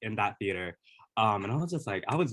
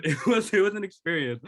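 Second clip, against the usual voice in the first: laughing through the words, higher; giddy laugh as she remembers; light laughing; 10 semitones higher, 6 dB louder than usual. Real, not acted. Laughs while recalling the win.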